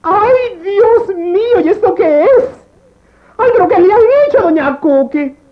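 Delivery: gritos de horror teatrales; ¡ay! exagerado, casi llorando; lamento histérico. Acted. Estilista ve a la clienta pelona. (lamento histérico)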